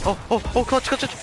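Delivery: excited cheer. Real, not acted. Teammate revived at last second.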